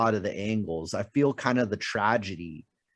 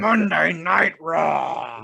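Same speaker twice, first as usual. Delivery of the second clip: announcer call; 4 semitones higher, 3 dB louder, much slower than usual. Retold or imitated.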